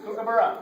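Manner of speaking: Kookaburra called out loud and clear; clear model word, loud. Shown as a demonstration. Teacher calling out a word for the children to echo, loud. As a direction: say Kookaburra loudly for the class to echo. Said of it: "Repeat after me".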